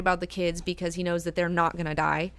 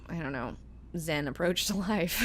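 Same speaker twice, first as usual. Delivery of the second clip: normal talking voice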